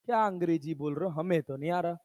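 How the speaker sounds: low, quiet, quick mumbled complaint; softly played puzzled pupil; baffled student. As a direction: lightly voice a lost student, under the breath.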